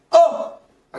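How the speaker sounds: high, ringing "O"; resonant, strong. Shown as a demonstration. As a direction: let the vowel ring out strongly. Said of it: "ça sonne très fort". (un « O » très fort qui résonne)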